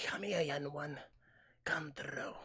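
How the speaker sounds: creepy slow beckoning, half whispered; cave creature voice. Retold or imitated. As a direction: lure them into the cave. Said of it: "a voice that would, you know, take you into a cave".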